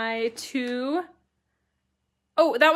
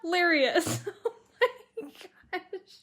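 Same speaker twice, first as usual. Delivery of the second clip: laughing; 9 semitones higher, 4 dB louder than usual. Real, not acted. Host laughing at comment.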